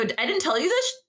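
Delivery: high, startled question; surprised. Retold or imitated. To her friend, realizing she never mentioned the trip, surprised. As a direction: sound caught out that you forgot to mention it. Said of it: "I go".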